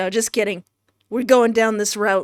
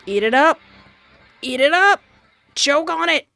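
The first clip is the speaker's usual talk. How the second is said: jeering shout; 6 semitones higher, 4 dB louder than usual. Acted. Jeering as mice devour heart.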